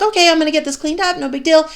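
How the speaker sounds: quick, high and upbeat, shrugging it off; breezy cheerful self-talk. Retold or imitated. Mom cleaning spilled milk, breezy and upbeat. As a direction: chirp it fast like it's nothing. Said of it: "And you're like".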